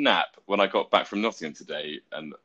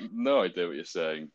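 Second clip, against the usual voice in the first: ordinary delivery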